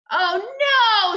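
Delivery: loud cry of alarm; dismayed cry. A narrator's reading. Sheila hearing the teeth are gone, dismayed cry. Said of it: "Sheila cried".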